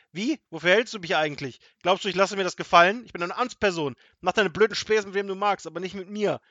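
loud, high, outraged tirade; furious officer shouting threats; fit of rage. A narrator's reading. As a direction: rant at full volume, offended authority. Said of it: "bekam einen Wutanfall".

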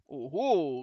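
loud "oho"; booming exclamation; called out. A narrator's reading. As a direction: call it out with swagger.

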